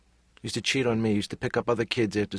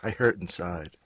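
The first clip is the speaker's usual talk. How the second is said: ordinary talk, nothing marked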